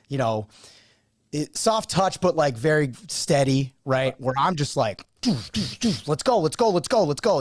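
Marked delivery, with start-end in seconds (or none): 5.2-7.4 hyped coach chant, higher; imitating his own locker-room hype; pump-up speech. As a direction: fire up the team before the game. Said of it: "I'm doing the pump up speeches".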